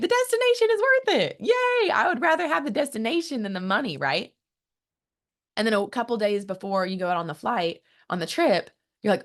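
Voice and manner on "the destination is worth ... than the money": much higher, giddy excited quote; excited traveler. Retold or imitated. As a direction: gush like a thrilled traveler who just booked. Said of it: "You actually spent that money happily".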